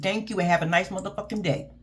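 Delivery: ordinary delivery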